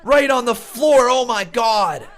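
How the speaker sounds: wrestling commentator. Real, not acted. Live play-by-play of match.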